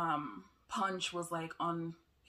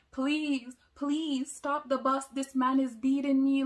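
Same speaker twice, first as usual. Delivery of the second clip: higher, louder, pleading cry for help; desperate plea; 7 semitones higher, 9 dB louder than usual. Retold or imitated. Nursing student begging the bus driver to stop, in a high, loud, desperate voice. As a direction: beg the driver as if you are being hit. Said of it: "and I'm like".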